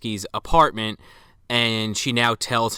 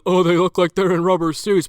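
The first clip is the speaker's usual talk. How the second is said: higher, louder mock complaint; mocking naysayers; 7 semitones higher, 7 dB louder than usual. Imitating people who dismiss the rubber suits, mocking. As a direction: put on a whiny critic, pitched up. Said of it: "some people out there that are like".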